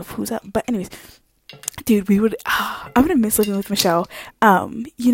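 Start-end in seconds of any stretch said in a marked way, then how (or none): none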